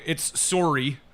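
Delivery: Canadian "sorry", rounded o; "sorry" said as SORE-ee in Canadian; Canadian accent. Shown as a demonstration. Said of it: "Canadian accent going for you".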